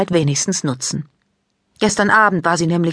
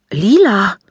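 a startled, louder call of her friend's name; calling out in surprise; surprised call; 3 semitones higher, 6 dB louder than usual. A narrator's reading. Maike sees Lila at her window, surprised call. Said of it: "ruft Maike überrascht".

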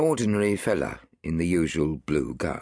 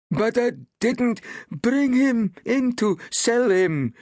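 high, slow, pleading villager on the verge of tears; near weeping; 5 dB louder, slower than usual. A narrator's reading. Villager begging the crowd, high and almost weeping. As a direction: plead it, almost crying, pitched way up. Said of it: "cried the villager, almost weeping".

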